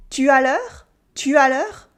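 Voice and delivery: "Tu as l'heure" swooping up on "l'heure"; rising question intonation. Shown as a demonstration. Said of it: "my voice goes up".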